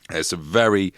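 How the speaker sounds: ordinary talk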